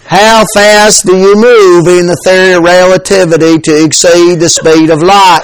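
physics question in a thick Southern accent. Shown as a demonstration. Preacher trying to make physics sound dumb, Southern accent. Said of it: "if it's a Southern accent, you sound dumber".

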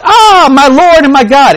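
overwhelmed worship at the sight of Jesus; Thomas, stunned, falls to worship; awestruck Thomas. Retold or imitated. Thomas sees the risen Jesus.

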